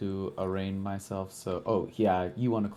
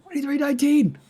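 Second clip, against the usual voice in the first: very high, loud yell; excited shout; 11 dB louder than usual. Real, not acted. Player crowing over both perception check rolls, excited shout. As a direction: holler it at the top of your range.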